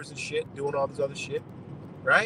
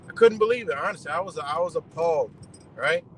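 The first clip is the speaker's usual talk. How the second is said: ordinary delivery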